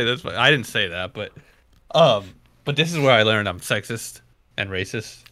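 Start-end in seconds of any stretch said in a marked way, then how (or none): none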